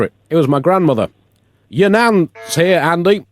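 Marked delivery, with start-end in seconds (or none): 1.7-3.3 higher, slow sing-song call; announcing visitor. Acted. Friend calls Andy to the door, sing-song higher. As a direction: call it through the house.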